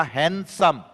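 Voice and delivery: "Handsome" split as hand... सम; teacher breaking "handsome" into two parts; pause between syllables. Shown as a demonstration. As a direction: stop midway through "handsome", before सम. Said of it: "जब बीच में मैं कहीं रुकूं".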